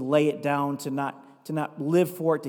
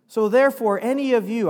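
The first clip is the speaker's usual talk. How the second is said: louder, higher emphatic scripture read; forceful proclamation; 5 semitones higher, 7 dB louder than usual. Acted. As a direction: proclaim it like a verdict. Preacher repeats the verse forcefully.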